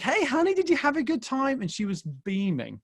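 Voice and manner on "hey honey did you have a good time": put-on high, sing-out hello to a child; excited dad greeting. Father collecting his daughter from kids' church, excited. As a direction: imitate a delighted dad greeting his little girl.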